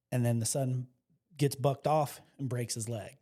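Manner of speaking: usual speaking voice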